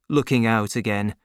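plain reading voice

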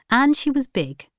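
usual speaking voice

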